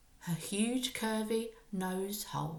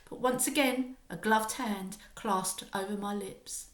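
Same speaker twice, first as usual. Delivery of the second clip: usual speaking voice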